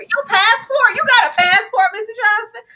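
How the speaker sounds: high, loud mock shock; shocked disbelief. Teachers squealing at Mister Johnson's passport.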